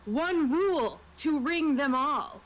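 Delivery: ordinary delivery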